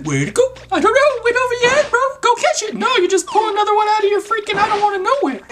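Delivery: very high squeaky mock protest; mock exasperated rant. Mock argument over lost ball.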